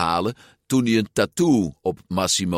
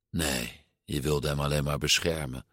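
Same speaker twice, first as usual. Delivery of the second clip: quiet; 5 semitones lower, 7 dB quieter than usual. A narrator's reading. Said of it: "zei hij".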